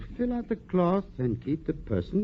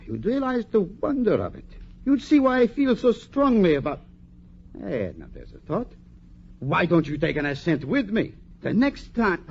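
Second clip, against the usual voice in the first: ordinary talk, nothing marked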